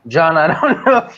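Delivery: laughing through the words; real laughter while talking. Real, not acted. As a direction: giggle your way through the tease. Teasing reply, laughs mid-line.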